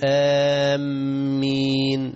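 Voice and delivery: "آمين" بتشديد الميم ومد مبالغ, خطأ; غنِّ "آمين" بميم مشددة خطأ; wrong doubled meem. Shown as a demonstration. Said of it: "ما ينفعش الإنسان يقول". (آمين بتشديد الميم الخطأ)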